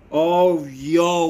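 loud, high burst of delight; real thrilled shout; excited shout. Real, not acted. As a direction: yell it out, thrilled by the pull. Pulls a hyper rainbow card.

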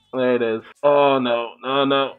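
ordinary talk, nothing marked

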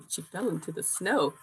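ordinary delivery, nothing marked

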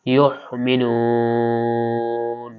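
ইউমিনুন with a throat catch after ইউ, very slow; pressed-throat hamza. Shown as a demonstration. Teacher shows the hamza with sukun, caught then drawn-out ইউমিনুন. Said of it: "আমরা একটু গলা চেপে পড়বো".

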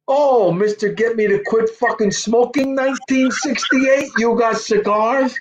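put-on high, slow, teasing old-man voice; father's voice. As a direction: rib your son with a slow mocking drawl.

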